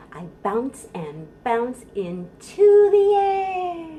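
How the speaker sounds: slow sing-song rhyme, swelling on the bounces; bouncing rhyme. Shown as a demonstration. Librarian chanting a bouncing rhyme for babies. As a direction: bounce the words and let the last line drop. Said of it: "it goes like this".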